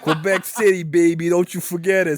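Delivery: brash fake Quebec City accent, drawn-out ending; hammed-up Quebec accent, stretching the last words; mock Quebec City accent. Comic cheers for Quebec City, mock Quebec City accent. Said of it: "That is famously the Quebec City accent".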